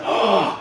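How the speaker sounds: high gasped "oh"; astonished gasp. Retold or imitated. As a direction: imitate a stunned kid's gasp. Boy startled on recognising Batman.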